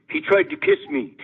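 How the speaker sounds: high, mock [name] voice; high, innocent-sounding [name]; [name] deadpan. [name] explaining the bloody bass player, in a high mock voice.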